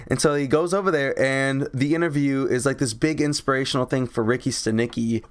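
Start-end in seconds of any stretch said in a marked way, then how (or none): none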